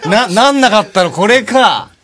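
overjoyed thanks; delighted. Real, not acted. Thanking for the gift. (大喜びで)

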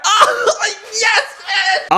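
high, squeezed cry of joy; excited squeal. Real, not acted. Player gloats over his best cards, high gleeful squeal. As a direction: squeal with glee. Celebrating the recap.

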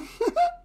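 short, high-pitched burst of laughter; amused laugh. Real, not acted. Listener tickled by the narrator's Australian accent, laughing. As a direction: break into a quick high laugh.

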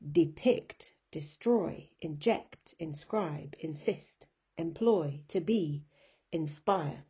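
each word, Depict to inspire, weighted on its second syllable; iambic stress. Shown as a demonstration. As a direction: list Depict, destroy, inject as separate da-dums. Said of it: "you'll hear the da-dum sound".

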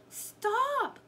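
high, loud cry of "Stop!"; storybook girl shouting to halt them. A narrator's reading. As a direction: call out in alarm, pitch way up. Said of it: "cried Annie".

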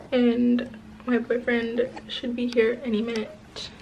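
usual speaking voice

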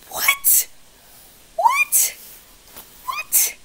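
very high squeaky whats; shrill incredulous squeaks; baffled squeak. Real, not acted. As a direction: squeak in disbelief each time. Baffled repeated what.

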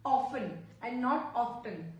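often "off-en", not "off-ten"; silent t in often. Shown as a demonstration. Teacher contrasts often "off-en" with "off-ten". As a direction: drop the T in often. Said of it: "letter T is silent here".

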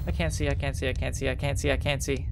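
low, soft rapid repetition; hushed gamer panic; tense muttering. Real, not acted. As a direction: repeat it nervously under your breath. Tense repeated muttering in game.

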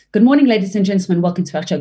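quick greeting, quiet close; fast then soft. Shown as a demonstration. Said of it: "using a different speed".

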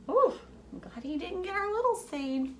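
high, gasping relief; high relieved ooh. Pretend. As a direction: sound glad the seed is safe. Relief seed not eaten.

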